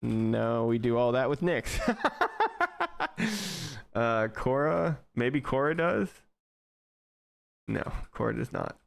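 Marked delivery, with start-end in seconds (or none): 1.8-3.9 high, pulsing chuckle; real, drawn-out high laugh; amused laugh trailing after the words. Real, not acted. Amused at finding no Docker.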